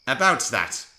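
frowning. Acted. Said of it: "frowned the thin controller".

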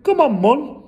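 put-on loud, high boyish call; eager urging. A narrator's reading. Brother urges his mum onward, eager. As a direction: chivvy her along keenly.

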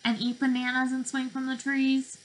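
put-on lively, sing-song picture-book voice for little kids; reading to children. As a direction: read it like circle time, drawing them in.

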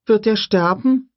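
put-on a curious little girl asking; young girl voice. Lex asks Grant about the raptor, high child voice.

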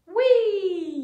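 long, loud whee sliding down; high playful squeal of "whee"; gleeful whee. Pretend. Teacher drawing a line for kids, gleeful whee.

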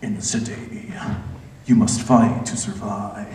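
very low, hushed trailer narrator; slow, ominous film-promo delivery; movie trailer voice. Acted. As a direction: draw it out deep and dramatic. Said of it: "anything with that voice".

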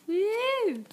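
high loud whoop; loud excited whoop; cheer. Real, not acted. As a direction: let out a big excited woo. Cheering at own drawing.

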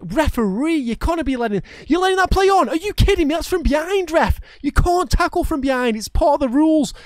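high, loud protest at the referee; outraged at the ref. Real, not acted. Player yelling at referee over a foul from behind, furious. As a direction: yell your outrage up at the ref.

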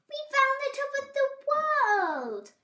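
very high, loud triumphant squeal; excited cry. A narrator's reading. Squirrel announces the hilltop, excited cry. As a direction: shout it in high-pitched delight. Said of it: "Squirrel cried".

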